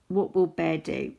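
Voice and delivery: ordinary delivery, nothing marked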